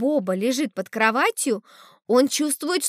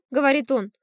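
ordinary delivery, nothing marked

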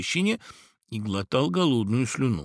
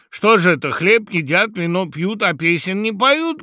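high, loud rallying question; shouted complaint; 8 semitones higher, 9 dB louder than usual. A narrator's reading. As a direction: call out to rouse the table. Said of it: "крикнул вдруг ворон".

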